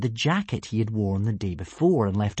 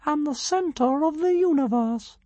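tiny dragon, much higher, pompous; grand squeak; slower than usual. A narrator's reading. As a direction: squeak it out like a self-important mite. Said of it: "squeaked the tiny creature grandly".

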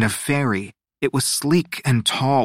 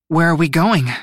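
higher, louder eager question; buzzing with excitement; 6 semitones higher, 5 dB louder than usual. A narrator's reading. Atsi asking Luna the destination, excited. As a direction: ask it bursting to know. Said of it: "practically buzzing on the edge of her seat".

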